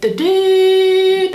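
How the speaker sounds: long high held beep; dial tone imitation. Telling the manager what she heard on the line, a high beep. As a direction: mimic the dead line tone.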